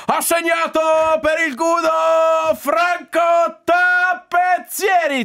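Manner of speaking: high, slow booming goal call; stadium announcer. Retold or imitated. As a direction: announce the scorer like San Siro. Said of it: "lo speaker tipo Germano Lanzoni di San Siro che dice". (annuncio da speaker dello stadio)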